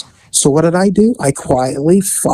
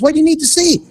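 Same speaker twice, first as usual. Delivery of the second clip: much higher imitation of a wary child; suspicious little girl; 11 semitones higher, faster than usual. Little girl challenges officer wanting a look, suspicious. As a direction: demand an answer in a high kid voice.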